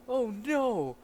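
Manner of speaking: high drawn-out cry of alarm; hammed-up panic; mock dismay. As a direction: cry out like you've been caught. Caught thief cries out in story.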